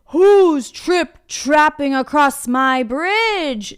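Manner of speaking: slow, loud troll roar; screaming troll. A narrator's reading. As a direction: roar it at the intruder. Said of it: "screamed the troll".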